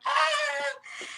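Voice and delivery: high thin mock scream; a startled fan's squeal; retold scream. Squealing at the phone about a famous caller. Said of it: "And I screamed".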